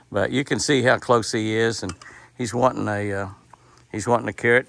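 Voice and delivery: ordinary delivery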